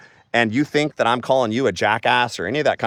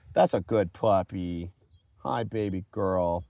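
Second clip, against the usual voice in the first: tender pet talk; 3 semitones lower, 7 dB quieter, slower than usual. Real, not acted. Talking softly to his dog.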